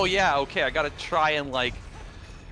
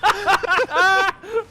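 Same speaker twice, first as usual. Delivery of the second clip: high laugh; 7 dB louder than usual. Real, not acted. Laughing at silly accents.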